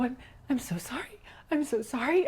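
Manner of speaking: high, mock-crying apology; imitated tearful apology. Woman retells crying as she apologises to him, high pleading apology. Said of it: "I'm crying and".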